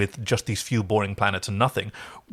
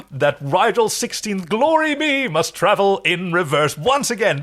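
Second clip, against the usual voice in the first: high, slow, grandly indignant; pompous Dominar; 6 semitones higher, 3 dB louder than usual. Retold or imitated. Rigel complaining to the crew about reversing, high and pompous. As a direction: declaim like offended royalty.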